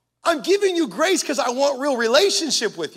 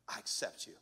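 soft breathy whisper; 20 dB quieter than usual. Retold or imitated. Girlfriend answering her fiancé's confession, whispered. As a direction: whisper it like a tender secret.

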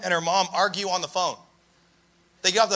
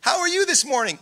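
high, sweet morning phone greeting; put-on chirpy mom voice; mother-in-law as if nothing happened; 7 semitones higher than usual. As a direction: imitate a mom breezily greeting her daughter. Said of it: "last night's conversation never happened".

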